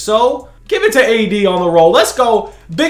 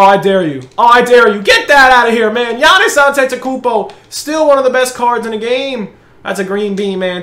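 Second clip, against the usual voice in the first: ordinary talk, nothing marked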